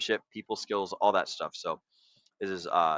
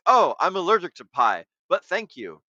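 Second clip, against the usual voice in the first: polite refusal; 7 semitones higher than usual. Pretend. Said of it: "And then they're like".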